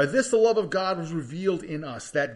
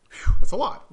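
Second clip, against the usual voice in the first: breathy louder, higher gasp of surprise; astonished phew; 6 semitones higher, 13 dB louder than usual. Real, not acted. Preacher to congregation at seventy-seven times, astonished phew. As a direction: blow out air at the huge number.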